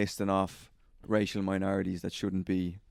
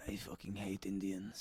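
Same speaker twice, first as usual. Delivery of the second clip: low muttered quote; 3 semitones lower, 17 dB quieter than usual. Retold or imitated. Boss's racist remark retold.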